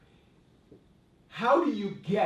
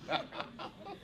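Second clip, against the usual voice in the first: breathy laugh; 6 semitones higher, 11 dB quieter than usual. Real, not acted. Laughing at reveal.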